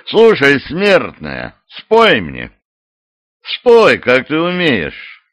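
slow, lordly command; commanding villain. A narrator's reading. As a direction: order her to sing. Bishop demands song.

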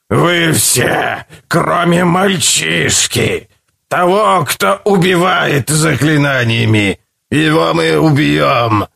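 slow raspy commanding monster; commanding creature. A narrator's reading. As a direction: demand total obedience, slow. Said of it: "голос более громкий и хриплый, требующий".

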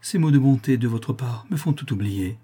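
ordinary delivery, nothing marked

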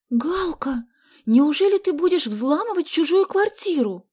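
high, loud incredulous question; surprised. A narrator's reading. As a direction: ask in shocked disbelief. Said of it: "с удивлением спросила Соня".

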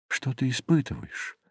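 very soft, intimate question; softly probing, almost under the breath; hushed questioning. A narrator's reading. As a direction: drop to a hush to ask the dead. Narrator questions the silent ghost.

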